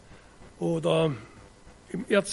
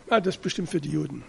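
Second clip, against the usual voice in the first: mock dismissive aside; 4 semitones lower, 6 dB quieter, much faster than usual. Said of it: "und sagen".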